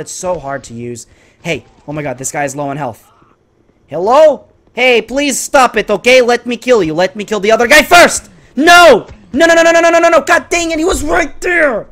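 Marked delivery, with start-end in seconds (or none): 3.9-8.3 high, loud protest; exasperated pleading. Real, not acted. Pleads with interfering enemy. 8.6-11.9 very high shouted wail; anguished protest. Real, not acted. Missed the kill.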